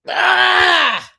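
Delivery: loud high cry of frustration; sudden exasperated "Ah!"; frustrated shout. Real, not acted. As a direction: let out a sharp yell. Frustrated outburst mid rant.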